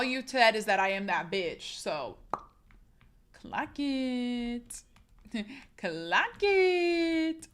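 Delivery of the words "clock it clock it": sassy clock it. Acted. Sassy catchphrase.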